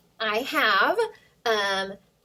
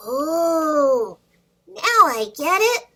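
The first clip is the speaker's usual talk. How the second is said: happy realization; 6 semitones higher, 8 dB louder, slower than usual. Acted. Dragon suddenly understands.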